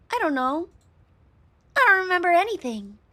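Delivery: high-pitched, cutesy innocent; cute girl voice. Acted. Slime girl with no memory answering, high and cute. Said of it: "Wobbly, high-pitched, and very cute".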